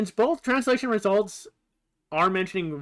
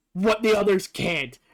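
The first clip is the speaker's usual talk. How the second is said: ordinary delivery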